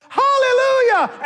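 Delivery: shouting praise when the bus speeds off; joyful hallelujah. Retold or imitated. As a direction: sing out the praise. Celebrating answered prayer.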